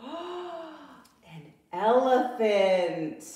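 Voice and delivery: slow, drawn-out gasp of wonder; amazed reveal. Acted. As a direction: unveil it with awe.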